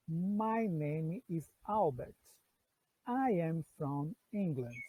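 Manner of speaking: slow, clear English read for learners; slow model sentence. Shown as a demonstration. Teacher reads the example to students slowly. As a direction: read each word apart, slowly. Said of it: "Vou repetir".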